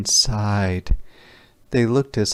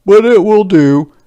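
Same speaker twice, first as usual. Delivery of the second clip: much higher and louder, grand; proclaiming soldier; 11 semitones higher, 10 dB louder than usual. Acted. Soldier announcing stone soup to villagers, grand raised voice.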